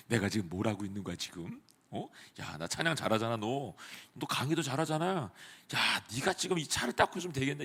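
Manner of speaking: soft, muttered self-pity to himself; grumbling inner voice. Retold or imitated. Staff member grumbling to himself while washing cars, quietly bitter. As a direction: mutter resentfully under your breath. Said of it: "이 마음이 계속 자기 안에 있는 거죠".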